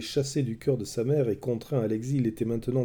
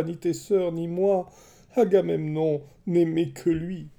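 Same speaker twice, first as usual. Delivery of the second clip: raised, higher grieving voice; bitter defiance; 5 semitones higher, 3 dB louder than usual. A narrator's reading. Grieving queen defying her son, high and bitter. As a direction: spit out old grievances through grief.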